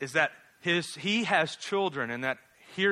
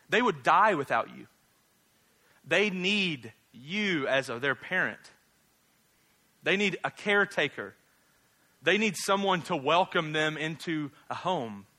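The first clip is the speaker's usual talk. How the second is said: normal talking voice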